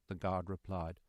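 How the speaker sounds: usual speaking voice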